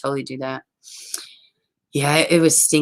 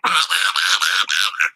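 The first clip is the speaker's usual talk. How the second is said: low, rapid repeated quacking; mimicked duck racket; imitated crazy duck; 8 semitones lower than usual. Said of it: "Then here he comes back".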